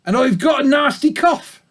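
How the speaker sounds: high, loud rough sheep voice; old ewe complaining of her cough; rough-voiced old ewe. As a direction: croak it out like a sickly old ewe. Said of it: "She can have a rough voice like that".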